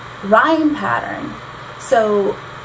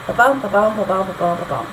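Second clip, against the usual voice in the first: five ba-BUMs, each BUM stressed; iambic pentameter beat; faster than usual. Shown as a demonstration. Teacher showing five feet in a line, iambic beat. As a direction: tap out ba-bum five times, weight on BUM. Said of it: "five of them in a line".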